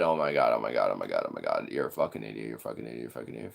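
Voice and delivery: fading to a low, soft mutter; exasperated mutter. Real, not acted. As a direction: sink into a quiet, disgusted grumble.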